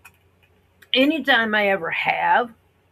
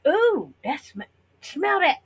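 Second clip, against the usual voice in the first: mock eager sniffing; 8 semitones higher than usual. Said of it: "one of my kids and my husband go".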